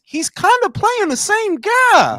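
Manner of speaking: high, slow, drawn-out argument; emphatic insistence. Real, not acted. Friend argues all those movies match, high drawn-out insistence. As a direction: push the pitch up and stretch the point. Driving home the same-character argument.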